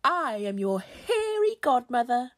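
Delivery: booming fairy-godmother proclamation; loud hairy godmother. A narrator's reading. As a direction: announce yourself with theatrical importance. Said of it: "came a loud voice".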